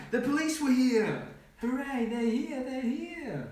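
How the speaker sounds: high, drawn-out hooray; storybook cheer. A narrator's reading. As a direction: cheer the rescuers' arrival in a high voice. Story's cheer as police arrive.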